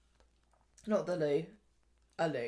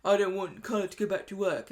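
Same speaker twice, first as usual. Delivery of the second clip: mock stern Peter; 3 dB louder than usual. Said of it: "He's like".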